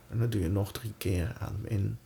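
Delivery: very soft, slow breathing cues; soft spa whisper. Acted. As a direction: hush it down to guide the breath. Counts down the breaths.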